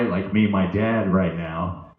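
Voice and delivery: usual speaking voice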